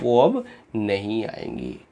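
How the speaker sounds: ordinary talk, nothing marked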